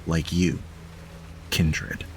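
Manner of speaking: slow, hushed scene setting with "hungry entity" breathed out; game master narration. Acted. Game master tells Max what she senses, hushed and slow. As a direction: draw the players into the dark.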